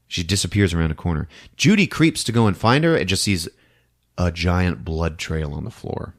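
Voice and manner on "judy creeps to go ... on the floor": ordinary delivery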